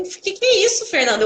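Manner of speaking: high-pitched startled reproach; retold astonishment. Physiotherapist to patient arriving in wheelchair, astonished.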